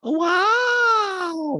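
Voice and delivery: loud high drawn-out mock wow; Diana. Diana rolling eyes at Sutton, high loud impression. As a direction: wail it scornfully up high. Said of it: "and she's like".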